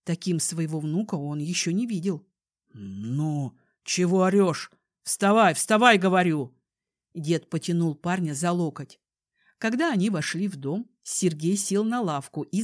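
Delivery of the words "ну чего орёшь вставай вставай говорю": loud, gruff scolding command; stern shout. Acted. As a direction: order him up, harshly. Grandfather scolds sobbing grandson.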